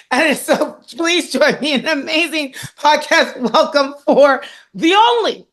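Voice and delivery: high, stretched announcer build-up; high, slow hype introduction; hype announcer welcome. Real, not acted. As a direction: bring the guest on like a host. Hyping guest welcome.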